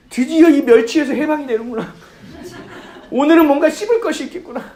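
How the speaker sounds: high, loud and laughing with joy; giddy childhood excitement. Retold or imitated. Recalling childhood, gushing over a rare meal out, giddy and laughing. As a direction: relive the thrill of finally eating out.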